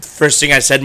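ordinary talk, nothing marked

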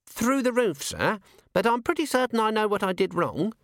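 put-on a young dwarf explains his blunder to the commander, eager and high; young dwarf alchemist.

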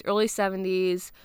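usual speaking voice

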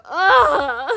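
loud laughing ugh; mock groan of despair; exasperated groan. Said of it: "he'll go".

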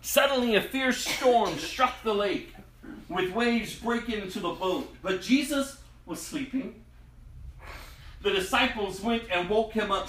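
ordinary delivery

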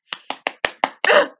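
loud, harsh throat-choking sound; mock choking noise. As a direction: imitate someone being choked, loudly. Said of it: "I'm choking him".

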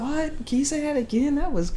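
high voice, disbelieving; imitating the woman who can't believe the name; high incredulous woman. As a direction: ask her to repeat it, baffled. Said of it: "She was like".

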